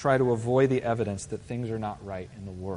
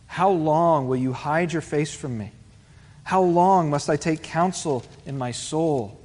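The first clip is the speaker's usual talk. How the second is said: ringing, pleading preacher's reading of a lament; impassioned scripture reading; 3 semitones higher, 7 dB louder, slower than usual. Acted. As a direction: cry out the psalm to God as a lament. Reads the psalm aloud with force.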